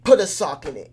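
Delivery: a mock agitated shout; mock cursing out. Shown as a demonstration. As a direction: bark it like you're fed up. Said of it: "if I were to curse you out".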